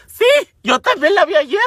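réplica aguda y fuerte; desesperación actuada. Retold or imitated. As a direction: contesta chillando, harta del vecino. (chillido exasperado y exagerado)

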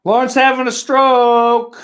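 high, loud, drawn-out call; mock-alarm holler; loud mock announcement.

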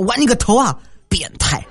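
high, indignant retort acted out; mock outburst. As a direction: imitate an angry partner exploding. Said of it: "随时都会爆发出来". (模仿女友突然爆发怒骂)

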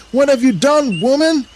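very high, loud outrage; furious shout. A narrator's reading. Adam accusing Eve over the fruit, outraged shout.

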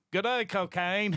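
a cheery fake Aussie greeting, overdone; bad Australian accent. Mock Australian pitching cocaine to friends, hammed-up. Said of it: "I'm doing the total wrong accent".

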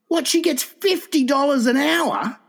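high, drawn-out incredulous exclamation; outraged disbelief. Retold or imitated. Friend recalling shock at a prisoner's wage, high incredulous voice. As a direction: stretch it out in astonished indignation.

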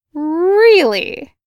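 can't believe he means it; disbelieving. A narrator's reading. Greta astonished Virgil will walk her home, disbelieving. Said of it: "she said disbelievingly".

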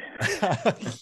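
high burst of laughter. Real, not acted. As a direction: break into laughter at the joke. Laughs at friend's joke.

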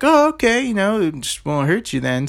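high mock-agreeing; sarcastic kid. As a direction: play along with mock belief. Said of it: "And I was like".

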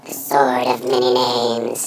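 low, slow menacing intonation. Pretend. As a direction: say it like a dark threat. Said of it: "That actually sounds kinda menacing".

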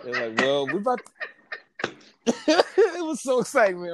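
high, laughing, bubbling over with excitement; giddy and laughing. Real, not acted. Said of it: "You sound like a little kid at Christmas".

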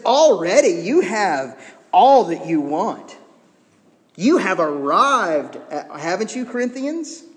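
loud, slow mock admiration; wholly sarcastic. Paul mocking the proud Corinthians, sarcastic. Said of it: "being wholly sarcastic".

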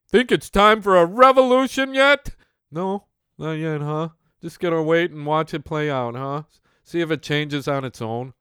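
high, loud mocking taunt; sarcastic taunt. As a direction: needle them with sarcasm. Sarcastic taunt at passive audience.

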